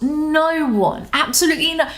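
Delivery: deadpan with exaggerated stretch; sarcastic. Shown as a demonstration. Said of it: "elongate your vowels".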